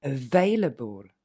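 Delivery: available slowly, weak schwa on the able; slow model word. Shown as a demonstration. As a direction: stretch out available, able unstressed. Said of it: "Et en une fois". (available lentement, able sans accent)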